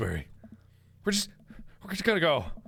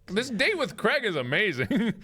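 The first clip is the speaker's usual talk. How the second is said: dreamy; 7 semitones higher, slower than usual. Acted. Dreamy about the date.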